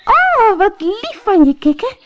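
very high, loud, overjoyed squeal; delighted princess. A narrator's reading. Princess thanks the frog, high and overjoyed. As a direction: gush with joy at the frog. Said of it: "klapte in haar handen van blijdschap".

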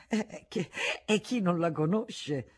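halting, slowed stammer; stammering repeat. A narrator's reading. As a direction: trip over the words nervously. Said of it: "ripeté balbettando".

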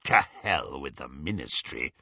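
low, scornful villain growl. A narrator's reading. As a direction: dismiss it in a deep growling voice. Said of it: "growled Greyback".

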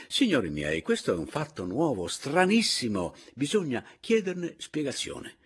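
loud, rising astonishment; agitated exclaiming. A narrator's reading. Paleari addresses the company about a strange event, agitated. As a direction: build to a high, stretched superlative.

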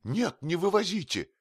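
high-pitched frantic contradiction; agitated, high refusal; desperate protest. A narrator's reading. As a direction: object in rising panic, voice way up. Desperate objection to the removal.

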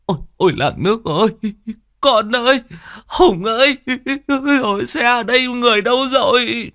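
a mother crying out for her son; wailing cry. A narrator's reading. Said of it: "bác Đinh gào khóc". (gào khóc thảm thiết, giọng rất cao)

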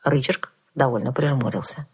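ordinary delivery

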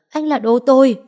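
shouting. Acted. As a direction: yell it at him, high and loud. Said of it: "Cô quát".

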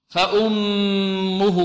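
فأمه very slowly with the doubled mim hummed; slow tajweed recitation; ghunna demo. Shown as a demonstration. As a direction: hold the nasal on the mim of فأمه. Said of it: "ميم مشددة غنة".

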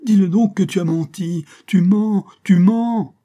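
accused man at the bedside crying out to Burton; crying and shouting. Acted. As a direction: scream through tears, begging him to confess. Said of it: "en pleurant et en criant".

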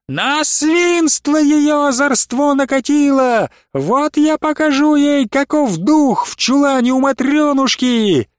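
высоко и медленно, грозно; грози на всю богадельню; гневный крик. A narrator's reading. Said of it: "закричала на всю богадельню". (гневный крик Сандулии)

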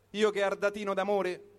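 ordinary talk

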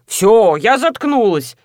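drawn-out, exasperated cry; half-shouted, stretched words; mild yell. Acted. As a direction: cry out slowly in surrender. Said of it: "закричала Людмила".

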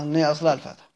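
normal talking voice

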